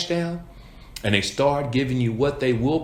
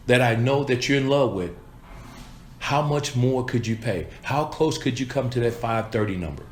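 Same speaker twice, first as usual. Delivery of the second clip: normal talking voice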